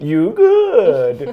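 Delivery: a cheesy, flirty "You good?"; mock Joey greeting. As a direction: play a cheap Joey, chatting someone up. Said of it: "the Walmart version of Joey".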